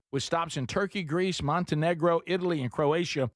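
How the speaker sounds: upbeat travel promo read. Acted. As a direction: sell the cruise, bright and smooth. Reads travel ad.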